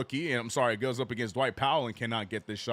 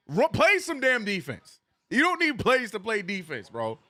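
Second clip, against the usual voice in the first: louder and higher, demanding defense; frustrated rant; 9 semitones higher, 6 dB louder than usual. Real, not acted. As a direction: yell at the screen in frustration. Fan ranting at his team.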